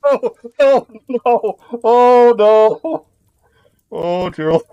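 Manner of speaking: high, drawn-out groan of disapproval; mock groan. Real, not acted. Reacting to Gerald's joke, in a high mock groan. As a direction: groan high and pained, like a terrible pun.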